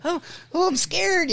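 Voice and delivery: high, mock-timid whimper; hammed-up frightened voice; mock weak and scared. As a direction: play it pathetically meek for the cop. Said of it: "being all, like, weak and shit".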